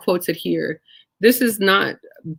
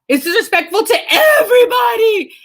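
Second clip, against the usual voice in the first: sudden high shout of outrage; outraged king; 7 dB louder than usual. Acted. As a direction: blow up like an insulted king. King's outraged reaction, quoted.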